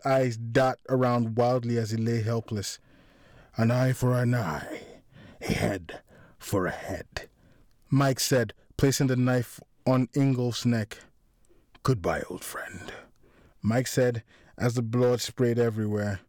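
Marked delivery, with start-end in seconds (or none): 3.6-7.3 grim verdict. A narrator's reading. Killer passes sentence over victim. 11.8-12.9 low, whispered goodbye; menacing farewell. A narrator's reading. Killer's farewell before the cut.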